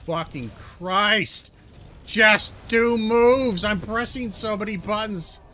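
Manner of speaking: drawn-out, high, furious yelling; exasperated shouting. Real, not acted. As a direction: explode in real frustration, voice way up. Losing a frustrating game fight.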